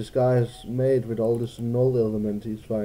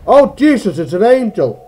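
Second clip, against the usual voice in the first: loud, high mock panic; hammed-up terror; 6 semitones higher, 10 dB louder than usual. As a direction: overact being terrified. Jokey fear at weeping angel.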